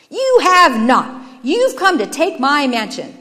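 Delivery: loud, high, slow opening denial; indignant, heavily stressed retort; angry accusation. Acted. Brothers quarrel through the window.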